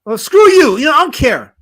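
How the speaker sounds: loud, high, indignant retort; indignant outburst. Real, not acted. Streamer answering chat about his accent, indignant outburst. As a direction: fire back at the teasing. Snapping back at accent teasing.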